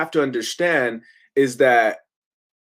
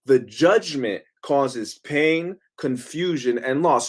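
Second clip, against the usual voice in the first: much slower, pause after each item; slow deliberate repeat; slower than usual. Shown as a demonstration. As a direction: lay out The judgment causes pain word by word. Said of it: "Let me say that again".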